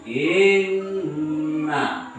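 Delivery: "Inna" with a long held double n; n read twice. Shown as a demonstration. Teacher shows the doubled nun, "Inna" drawn out. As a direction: linger on the nn of "Inna", then release na. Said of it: "nunnya dibaca dua kali".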